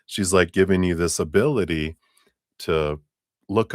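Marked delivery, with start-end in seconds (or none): none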